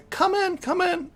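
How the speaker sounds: high, flustered call to come in; nervous, high-pitched invitation. Acted. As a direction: call out nervously, caught off guard. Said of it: "a nervous voice".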